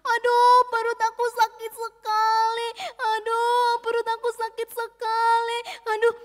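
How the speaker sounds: hammed-up whimper of a child with bellyache; enduring belly pain. A narrator's reading. Jojo holds back the bellyache, pained high whine. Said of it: "dia menahan rasa sakit perutnya".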